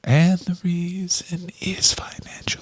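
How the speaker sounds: hushed breathy repeat of the line; whispered. Pretend. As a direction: whisper it like a secret.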